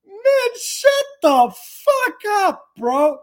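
high, loud, fed-up yell; drawn-out, high-pitched angry rebuke; exasperated outburst. Acted. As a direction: explode at the gushing fans. Snaps back at gushing comments.

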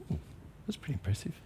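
very soft, thinking to itself; a small, hushed aside as the cub; impressed bear cub. Acted. Preacher voices the cub's private thought, quietly impressed. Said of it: "And the bear cub thinks".

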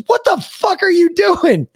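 high, slow incredulous yell; baffled drill sergeant. Retold or imitated. Drill sergeant asking the private what he is doing. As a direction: demand it in disbelief. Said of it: "He goes".

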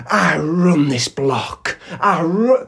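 slow, emphatic tough-guy boast, lightly voiced; imitated threat. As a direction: act out a man claiming his turf. Retelling stranger's threatening boasts.

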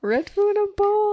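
piping high repeat; high squeaky echo. Pretend. Echoes very high.

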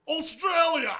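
high, loud Australian cry; mock Australian accent. Mocking the authentic Irish word, loud Australian accent. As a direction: shout it laughing in Australian. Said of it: "you're, you're like".

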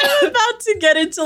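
words squeezed out through high laughter; giggling while trying to talk; laughing. Real, not acted. Laughing fit mid-sentence.

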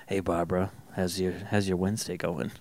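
low, soft sleazy greeting; greaser tough guy. Pretend. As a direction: lean in like a smooth greaser. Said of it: "You're like".

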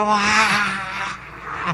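long breathy mock cheer; crowd roar imitation. Crowd going crazy for the hosts, mock roar. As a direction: imitate a wild crowd without full volume. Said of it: "la gente como loca".

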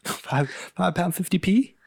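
high, slow mock British; British accent. Co-host echoes the clerk in a British accent. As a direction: repeat the price in put-on British. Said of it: "went all British accent".

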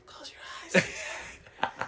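hushed, unvoiced whisper; sly whispered instruction, mock-intimate; whispered aside. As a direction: whisper it as if someone might hear. Acts out a sly bedside aside.

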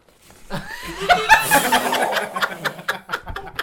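high, loud yelp of laughter through pain; pained laughing yelp. Real, not acted. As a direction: laugh and yelp as the cramp bites. Cramp hits mid-sentence.

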